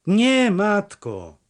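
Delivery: high, drawn-out reply; calling from afar. A narrator's reading. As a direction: answer slowly across a distance. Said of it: "odparł z daleka".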